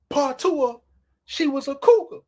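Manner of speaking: normal talking voice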